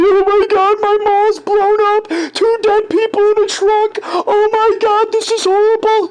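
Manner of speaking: hammed-up tearful cop wailing over the mall; high-pitched fake blubbering; mock crying cop. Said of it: "That's what he's saying".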